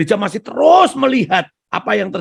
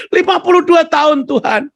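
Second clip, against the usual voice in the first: loud eager answer; 11 semitones higher, 6 dB louder than usual. Retold or imitated.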